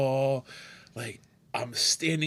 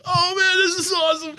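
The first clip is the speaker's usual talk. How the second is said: high, loud gushing; retold delight at meeting the owner, high excited shout; 5 dB louder than usual. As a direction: shout it like a starstruck fan.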